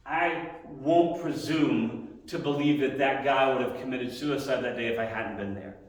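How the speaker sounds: ordinary talk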